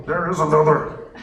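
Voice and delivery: a hint of a Yoda voice; lightly voiced Yoda. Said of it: "my Yoda sucks today".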